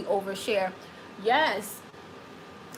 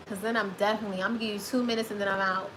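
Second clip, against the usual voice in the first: usual speaking voice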